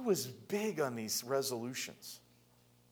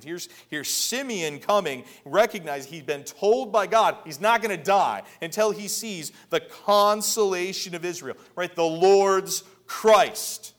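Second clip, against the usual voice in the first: loud, higher-pitched pulpit proclamation; preaching at full force; 6 semitones higher, 11 dB louder than usual. Real, not acted.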